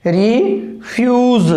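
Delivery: रिफ्यूज drawn out slowly as "ri-fyooz"; teacher modelling रिफ्यूज at half speed; slow demo pronunciation. Shown as a demonstration. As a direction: stretch रिफ्यूज out so students hear each sound. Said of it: "देखो".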